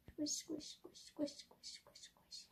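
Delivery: softly chanted squish squish, fading to a whisper; playful squish chant. Acted. Pressing vegetable into dough.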